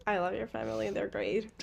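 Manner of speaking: soft unvoiced whisper. Real, not acted. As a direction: whisper it close to the mic. Whispering aside to partner.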